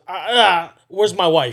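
loud mock-furious demand; imitated angry demand. Friend imitating a man who gets out the car, mock pissed off. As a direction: raise the voice like an irate husband. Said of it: "hella pissed off".

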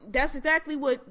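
ordinary talk

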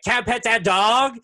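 put-on high, loud, slow toddler begging; excited toddler. Toddler on the trail begging to pet, high and loud. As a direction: beg like a little kid who wants the dog. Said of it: "he literally was like".

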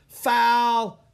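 high, loud drawn-out call; ringing umpire's yell; umpire's shout. A narrator's reading. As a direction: bellow the call across the field. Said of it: "the umpire shouted".

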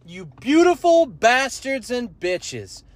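high, loud, drawn-out welcome yell; stretched, shouted stream intro; loud hype greeting. Pretend. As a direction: bellow the opener like a hype host. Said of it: "The volume at which I'm exercising".

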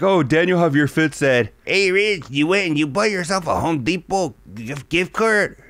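hammed-up accent, barely knows English; mocking broken-English accent. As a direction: imitate buddies mocking his accent. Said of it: "make me sound like I barely know English".

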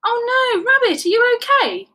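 high, loud, worried cry; distressed, urgent check; alarmed concern. A narrator's reading. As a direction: call anxiously to a trapped friend. Teddy finds friend in hole.

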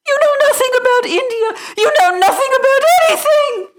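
put-on humiliated girl raging at the maid, shrieking; rage. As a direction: lose all control in fury. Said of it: "did not even try to control her rage".

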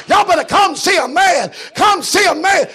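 loud high calling out; excited calling. Acted. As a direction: call the town to come. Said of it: "I ran back telling people".

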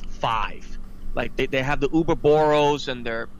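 ordinary delivery

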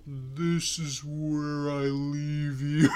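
very slow, hammed-up villain farewell; milk the cinematic slowdown; dramatic betrayal line. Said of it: "in the microphone I go".

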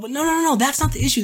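high, insistent string of no; emphatic no. Real, not acted. Correcting viewers' shrug, high and insistent. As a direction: cut them off, firmly. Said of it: "insisting to viewers".

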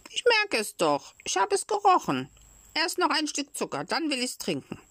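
put-on high-pitched, protesting puppet child; trotzige Holzpuppe. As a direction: argue back high, like a stubborn child. (hohe, trotzige Kinderstimme)

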